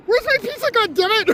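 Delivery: high, angry fake Indian accent; mock Indian accent, irate. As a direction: Raj demanding his pizza in a fake Indian accent. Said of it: "affect the thick, almost indecipherable Indian accent".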